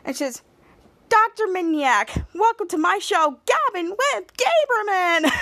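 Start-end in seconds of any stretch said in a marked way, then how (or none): 1.1-5.3 talk show host. Retold or imitated. Mary welcomes Doctor Minyak to her show, gushing host. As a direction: sing out the show's title in a high voice.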